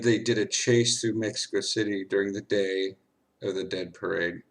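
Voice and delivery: normal talking voice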